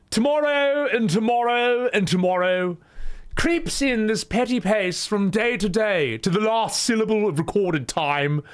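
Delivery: lofty, high, sing-song posh Shakespeare delivery; hammed-up plummy RP recital, pitched well up; posh theatre voice. Shown as a demonstration. Explaining how people think Shakespeare sounds, hammed-up posh recital. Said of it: "If it was posh".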